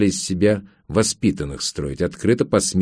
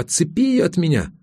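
отчаянная мольба; 8 semitones higher than usual. A narrator's reading. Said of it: "отчаянно пискнула".

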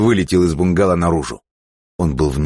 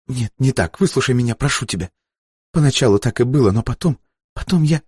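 high, anxious begging; desperate pleading; 9 semitones higher than usual. Acted. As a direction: plead to be heard, pitch climbing. Бланка умоляет выслушать её. (отчаянная мольба)

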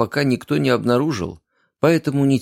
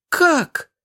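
shriek. A narrator's reading. Outraged girl shrieking at her lover. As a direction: shriek it in disbelief. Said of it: "взвизгнула девушка".

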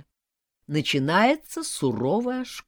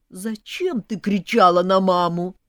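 louder, pained question; upset reproach; 3 semitones higher, 7 dB louder than usual. A narrator's reading. Groom to bride about his mother, upset. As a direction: demand a reason, hurt on mother's behalf. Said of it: "расстроенно спросил мой слепой кандидат".

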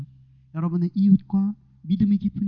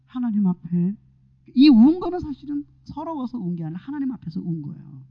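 ordinary delivery

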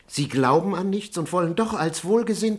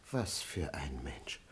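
entsetztes Flüstern; 12 semitones lower, 16 dB quieter, slower than usual. Acted. Diener entsetzt über Don Juan.

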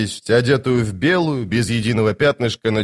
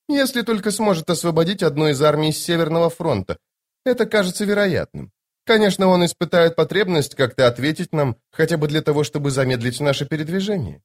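high lady's voice, musing; Lady Envy, assured; 5 semitones higher than usual. Acted. Lady Envy weighing the enemy's answer, high and assured. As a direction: reason it out in a high register.

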